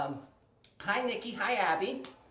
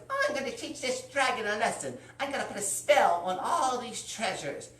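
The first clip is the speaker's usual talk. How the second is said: higher-pitched character voice, declaring a plan; heroine voice; 6 semitones higher than usual. Acted. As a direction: voice her high, resolute vow. Storyteller voices the scheming heroine.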